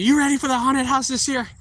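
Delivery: put-on high, eager child asking; eager kid voice. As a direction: mimic an excited kid pestering him. Customers' kids asking eagerly.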